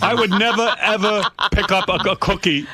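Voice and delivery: high voice breaking into laughter; laughing through a high father voice; laughing dad impression. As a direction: imitate dad while laughing.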